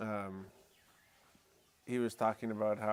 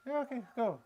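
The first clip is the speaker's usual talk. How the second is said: a much higher, startled yes; high surprised okay; 12 semitones higher than usual. Retold or imitated. Replying to a stranger who wants to pray, higher and startled. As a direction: squeak out a quick go-ahead.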